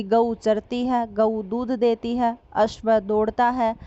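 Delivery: each example said slowly and clearly; slow full sentences. Shown as a demonstration. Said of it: "पूरा वाक्य का प्रयोग करें कि". (धीरे-धीरे, साफ़ पूरे वाक्य)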